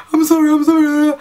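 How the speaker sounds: loud, high-pitched mock wailing; hammed-up tearful squeal; hysterical crying. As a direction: sob the apology in a squeaky, overblown cry. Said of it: "I was crying, like, hysterically".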